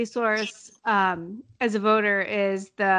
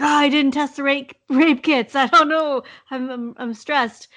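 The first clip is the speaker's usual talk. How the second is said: playfully hammed-up dismay, higher and louder; jovial mock stress; 5 semitones higher, 5 dB louder than usual. Voter torn over bombarding ads, jovial mock stress. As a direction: act out being a flustered voter, in jest. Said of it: "jovial manner".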